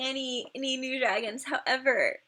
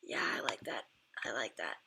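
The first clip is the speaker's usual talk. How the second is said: whisper; 10 dB quieter than usual. Real, not acted. Admiring a dragon under her breath.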